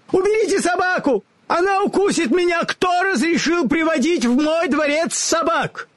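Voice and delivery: very high, panicked cry; frightened shriek. A narrator's reading. The little man shrieks at the dog in fear. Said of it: "с криком выскочил маленький человечек".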